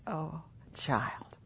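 very soft, breath-light answer; tender whisper. Acted. God answering a believer in quiet time, tender whisper. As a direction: whisper it lovingly, as God to a child. Voices God's loving answer, hushed.

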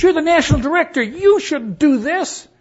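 loud, high-pitched indignant yelling; angry outburst. Retold or imitated. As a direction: blow up at him in anger. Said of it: "got this angry face".

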